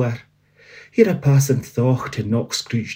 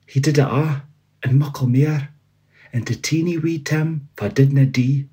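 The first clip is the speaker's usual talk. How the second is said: lightly voiced storybook reading in Doric; story narration; 4 semitones higher than usual. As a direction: tell the ending warmly, slowing at the close.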